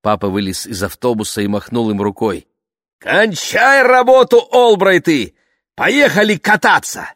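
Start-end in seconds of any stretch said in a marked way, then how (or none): 3.0-7.1 calling out. A narrator's reading. Father calls family from bus.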